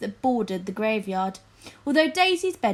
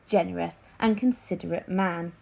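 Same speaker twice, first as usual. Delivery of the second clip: ordinary delivery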